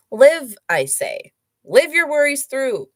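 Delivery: hammed-up booming pronouncement; mock grand proclamation. Shown as a demonstration. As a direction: declaim it like a pompous oracle. Rereading the line with mock grandeur.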